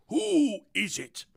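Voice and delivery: high, slow lordly question. Pretend. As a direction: play the lord of the manor. King answering butler.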